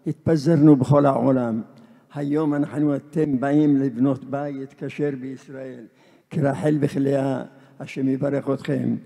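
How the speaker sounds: loud, forceful benediction; full-voiced formal blessing; proclaimed blessing. Real, not acted. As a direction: proclaim the blessing to the whole gathering.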